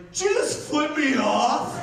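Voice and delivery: loud, high, furious challenge; angry shout. Retold or imitated. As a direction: bark it out like an enraged drunk. Angry drunk confronts him.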